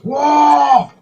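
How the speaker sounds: high, loud orc bellow; orc roar. Retold or imitated. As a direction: let out a wild orc whoa. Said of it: "He's an orc that goes".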